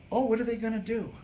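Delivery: ordinary talk, nothing marked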